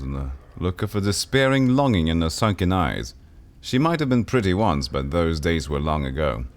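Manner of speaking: steady descriptive storytelling; game narration. Acted. Game master describing the bruised woman. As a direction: set the scene for the player.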